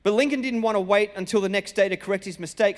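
ordinary talk

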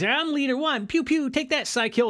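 high-pitched boy playing space battle; kid playing hero. Kid in his pod playing Leader One, high and playful. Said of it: "Well, he's like".

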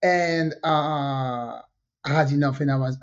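usual speaking voice

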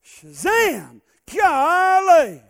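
"Golly!" yelled high and loud; Gomer Pyle-style exclaim. Acted. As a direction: ham up the country gawk for the crowd. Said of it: "A little Andy Griffith, uh, reference".

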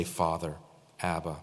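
very soft low Father, Abba; hushed prayer. Pretend. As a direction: call Father, Abba softly, like a child. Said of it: "we say".

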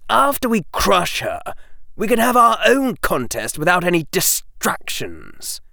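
put-on a sly young boy plotting against a rival; Draco voice.

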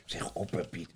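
usual speaking voice

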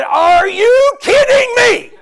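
loud, high, slow incredulous shout; God's voice. Retold or imitated. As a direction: thunder it out as God rebuking. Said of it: "He said".

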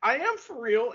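high, slow mock-indignant squeal; playing along indignantly. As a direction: keep the outraged act going on purpose. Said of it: "your tone of voice just now".

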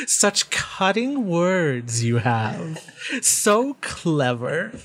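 slow, drawn-out mock admiration; chuckle, then stretch every word with relish; amused taunting being. Faceless voice answers Wiz's insult, slow and mocking.

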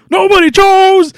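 loud, very high, drawn-out mock yell; pissed off. The movie hero protesting to the goddess, pissed off. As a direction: act out an angry protest, stretched out. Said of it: "but now he's pissed".

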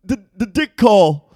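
mock Korean accent. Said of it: "How's my Korean accent?".

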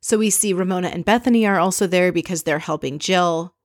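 ordinary talk

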